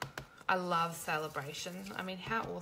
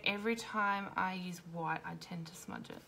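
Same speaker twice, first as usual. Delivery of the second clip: ordinary talk, nothing marked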